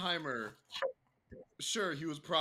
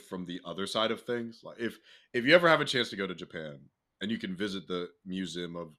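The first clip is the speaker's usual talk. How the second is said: sincere reflection; 10 semitones lower than usual. Real, not acted.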